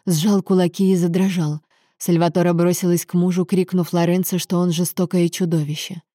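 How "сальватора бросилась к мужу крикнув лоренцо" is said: ordinary delivery, nothing marked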